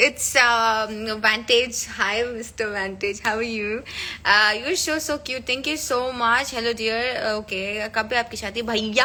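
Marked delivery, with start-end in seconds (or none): none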